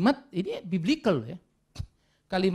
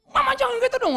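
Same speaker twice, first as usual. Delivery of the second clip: put-on high, loud pleading kid voice; children squealing at their mother in protest; whiny child protest; 5 dB louder than usual. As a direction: beg mama like a scared child.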